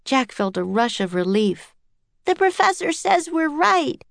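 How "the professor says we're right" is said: high excited yell. A narrator's reading. Annie telling the crowd they're right, yelling. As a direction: announce it in a high young voice. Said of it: "yelled Annie".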